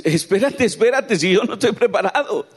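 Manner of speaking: high, loud panicked "espérate"; alarmed protest. Retold or imitated. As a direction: beg for time, flustered. (protesta asustada y alta)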